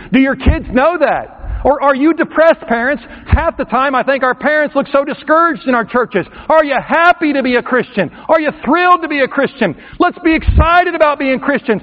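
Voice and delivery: fiery sermon climax; preaching at full force. Acted. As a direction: build it up and preach hard. Preacher rouses the crowd.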